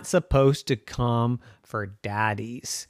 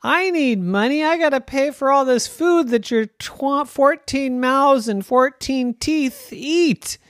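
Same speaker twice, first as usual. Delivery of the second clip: daddy voice; 11 semitones higher than usual. Acted. Father voiced in bedtime story.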